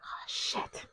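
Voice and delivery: under-breath whisper. Retold or imitated. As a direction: whisper it in dread. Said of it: "I was like".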